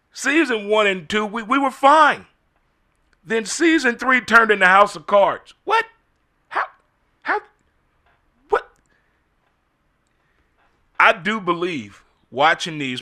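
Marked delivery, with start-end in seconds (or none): 0.1-8.7 louder and higher, rising outrage; high, louder exasperation at the show; indignant rant. Real, not acted. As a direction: vent about the plot twist like it wronged you. Ranting about the show's turn.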